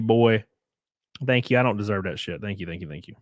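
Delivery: ordinary talk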